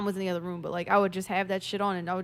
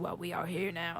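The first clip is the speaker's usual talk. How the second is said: mild Hellraiser voice; 3 semitones lower, 9 dB quieter than usual. Retold or imitated. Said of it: "he was so fucking funny".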